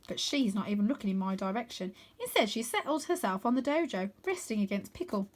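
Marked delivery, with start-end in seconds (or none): none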